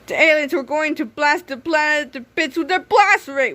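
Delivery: plain reading voice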